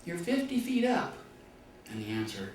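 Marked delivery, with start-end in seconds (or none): 0.0-1.2 shouted reply. Retold or imitated. Cyclist answers the balloonist.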